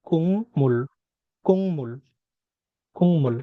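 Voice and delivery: "কুংমুল" with ng before m, falling at the end; nasalised pronunciation. Shown as a demonstration. Teacher drilling the changed sound, nasalised pronunciation. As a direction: repeat "কুংমুল" carefully with a hummed ng. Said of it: "এটার উচ্চারণ কি হবে".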